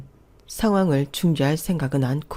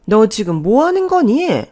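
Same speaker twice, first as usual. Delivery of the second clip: high, loud outraged outburst; sudden angry shout; 6 semitones higher, 8 dB louder than usual. A narrator's reading. Mother-in-law catches daughter-in-law opening the door, shouting angrily. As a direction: snap and yell at her. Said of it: "버럭 소리치더라고요".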